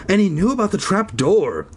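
lightly acted excitement; Zeke's voice. A narrator's reading. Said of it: "Zeke continued".

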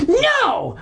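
very high, hammed-up shriek; shouted refusal. Boy stopping dad reaching for his dog, shouting. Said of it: "I shouted".

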